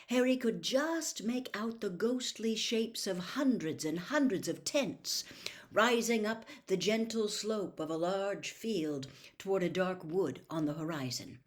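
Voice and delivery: usual speaking voice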